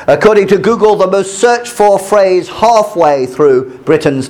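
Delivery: measured read-aloud of a news article. Acted.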